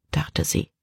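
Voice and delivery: plain reading voice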